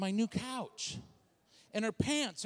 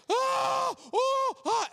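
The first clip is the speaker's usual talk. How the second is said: high, loud pained wails; acted labor screams; 6 dB louder, much slower than usual. Woman in labor in pastor's office, hammed-up screams.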